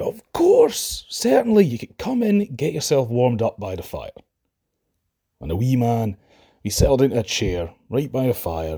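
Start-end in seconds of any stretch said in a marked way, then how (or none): 0.0-4.1 much higher and louder, hurried hospitality; a mother overdoing the welcome; Mally quickly inviting the stranger in; eager welcome. Acted. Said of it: "Mally said".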